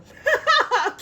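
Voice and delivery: high, loud laugh; real laugh bursting out; burst of laughter. Real, not acted. Laughing at her own courtroom threat, loud and high.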